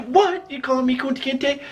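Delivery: high-pitched mock indignation; incredulous. Answering the stranger's insult, high comic disbelief. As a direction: question it high, as if astounded.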